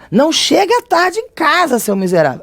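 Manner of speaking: furious mock scolding at a kid; exasperated scolding. Shown as a demonstration. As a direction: scold them for coming home late. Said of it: "Aí pronto, já basta, já tem a exclamação".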